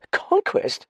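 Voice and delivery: very high, startled echo. A narrator's reading. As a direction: repeat it in high-pitched alarm. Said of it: "repeated Dallings, startled".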